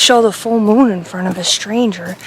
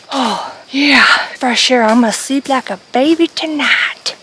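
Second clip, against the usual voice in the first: ordinary talk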